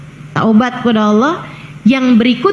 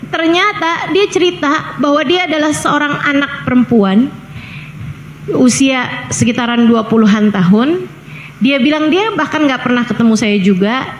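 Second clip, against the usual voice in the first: ordinary talk, nothing marked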